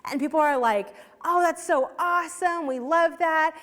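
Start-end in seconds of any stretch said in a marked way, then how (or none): 1.2-3.6 higher-pitched mock enthusiasm; gushing approval. Churchgoers cheering a fundraiser, imitated gushing approval. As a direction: imitate a crowd gushing over a good cause.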